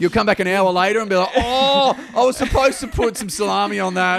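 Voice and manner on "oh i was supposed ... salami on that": higher, put-on dopey voice; mock forgetful pizza guy. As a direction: play the scatterbrained delivery guy. Mocking a forgetful pizza maker.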